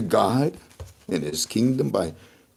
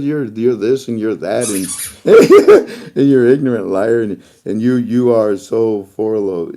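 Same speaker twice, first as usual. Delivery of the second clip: loud mock rant, breaking into laughter; hammed-up furious text, laughing through; loud mock insults with a laugh; mock angry quote; 7 dB louder than usual. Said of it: "and he says".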